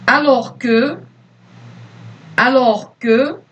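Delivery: slow, clear dictation voice; dictating. Acted. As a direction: read it out word by word for pupils. Teacher dictates to pupils.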